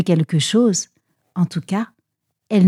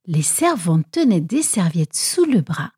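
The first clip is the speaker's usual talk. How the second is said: plain reading voice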